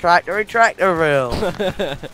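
slow mock West Country boast; Somerset farmer accent. Echoing a friend's tractor joke, Somerset farmer accent. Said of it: "I'm a farmer from Somerset".

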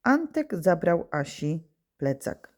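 corrected sentence read out slowly for copying; slow, clear dictation voice. Shown as a demonstration. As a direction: dictate it word by word. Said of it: "Czyli nowe zdanie będzie brzmiało".

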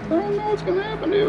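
high squeaky voice, quick, teasing the panel; playful high voice. Real, not acted. Builder teasing the hull panel that keeps lifting, in a playful high voice. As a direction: talk to the panel like a naughty pet, fast and high.

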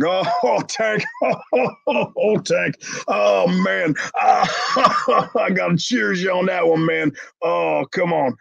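high-pitched imitation of helpless laughter; JBL laughing. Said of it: "J B L's laughing his ass off".

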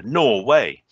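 put-on northern English accent, joke punchline. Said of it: "in a northern accent".

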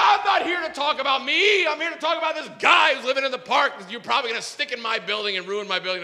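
high, fast indignant shouting; loud acted tirade, running down at the end; yelled rant. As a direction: imitate a man ranting without stopping. Acted yelling rant, told as keeps going.

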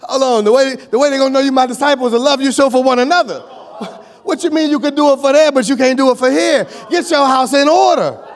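loud, high rebuke to the church; preaching at full force. Real, not acted. Preacher scolding church wives, loud and rising. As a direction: call them out, pressing hard.

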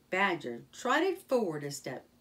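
ordinary delivery, nothing marked

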